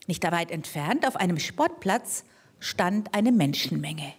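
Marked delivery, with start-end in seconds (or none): none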